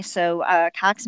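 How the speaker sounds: ordinary talk, nothing marked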